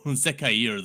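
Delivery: Zakair said smoothly as "za-kai-EER"; corrected Zakair pronunciation. Shown as a demonstration. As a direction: give Zakair a separate final "eer". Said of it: "Zakair, though, would be".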